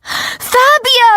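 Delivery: very high-pitched squeal of a name; high, thrilled call; excited fan shout. A narrator's reading. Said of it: "I start to shout".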